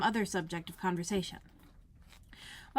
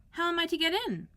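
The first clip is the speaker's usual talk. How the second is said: put-on much higher girlish voice; Alice asking the footman, high and polite; young Alice; 10 semitones higher, faster than usual. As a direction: pitch up as a polite little girl. Alice character voice.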